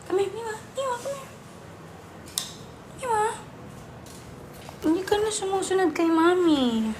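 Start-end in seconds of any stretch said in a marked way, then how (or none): 0.1-3.2 coaxing call. Real, not acted. Coaxing the cat over.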